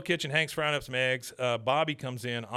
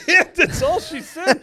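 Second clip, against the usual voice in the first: laughing; 12 dB louder than usual. Real, not acted. Host cracks up retelling.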